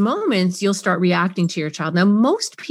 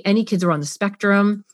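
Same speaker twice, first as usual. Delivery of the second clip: usual speaking voice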